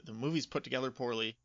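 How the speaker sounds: ordinary talk, nothing marked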